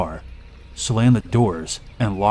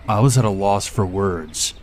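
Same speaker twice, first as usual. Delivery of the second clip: ordinary delivery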